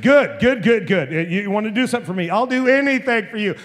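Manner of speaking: loud, high, pouncing on the offer; eager acceptance. Acted. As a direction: act out a dad jumping at his chance. Dad eagerly seizes the guilty man's offer.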